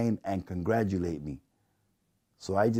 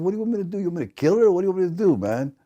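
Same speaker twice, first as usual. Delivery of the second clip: exasperated retort; 9 semitones higher, much faster than usual. Retold or imitated.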